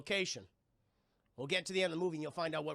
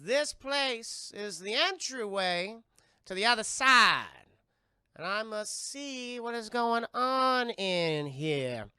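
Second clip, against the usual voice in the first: high, slow Southern pronouncement; Zelda's high voice; 4 semitones higher, 6 dB louder, slower than usual. Retold or imitated. Seer announcing the entryway to the other side, high slow drawl. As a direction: proclaim it in a drawn-out Southern voice. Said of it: "very high voice and everything".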